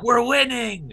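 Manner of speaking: high, loud victory whoop; mock triumphant cheer. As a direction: crow it like the fight is already won. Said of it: "so I'm like".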